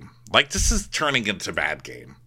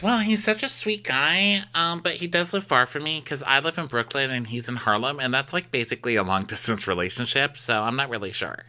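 put-on high girly voice; 6 semitones higher than usual. Voicing Rachel high.

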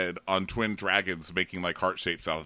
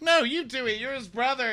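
much higher squeaky protest; high Sam voice; 4 dB louder, faster than usual. Retold or imitated. Sam squeaking at Bran to tell Jon himself. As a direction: push the job off nervously. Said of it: "And Sam's like".

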